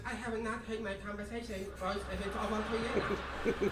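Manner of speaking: lightly put-on Chinese accent impression; a hint of a Chinese accent, comic; mock Chinese accent. Comic to the crowd, British woman in a mock Chinese accent. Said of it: "do that impression without squinting".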